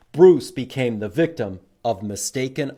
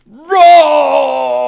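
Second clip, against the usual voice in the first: very loud, high mock roar; bear roar; 12 semitones higher, 17 dB louder than usual. Reader showing children how an angry bear roars. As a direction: roar like a mad bear for the kids. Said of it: "What do bears say when they get mad".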